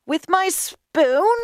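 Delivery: high, slow, rising question; high woman's character voice; incredulous woman. A narrator's reading. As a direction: stretch it out in high-pitched disbelief. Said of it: "asked Leah".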